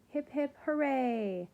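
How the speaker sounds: high, loud, drawn-out cheer; yelled cheer. A narrator's reading. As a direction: cheer along with the kids. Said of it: "yelled the Udam kids".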